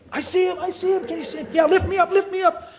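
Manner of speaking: put-on a child at the arrival gate, beside himself with joy; excited child. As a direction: squeal like a kid spotting a parent at the gate.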